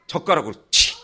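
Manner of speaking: ordinary talk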